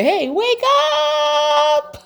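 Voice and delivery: wake-up call. Acted. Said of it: "and say".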